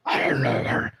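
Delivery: low, distorted monster growl. Retold or imitated. Wife disguising her voice to a male caller, monster voice. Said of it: "monster sound".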